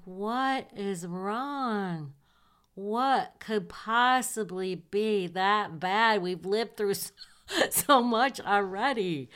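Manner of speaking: slow, high and pleading; drawn-out worried questions to a crying friend; alarmed concern. Retold or imitated. Talking to crying roommate Cindy, slow alarmed concern.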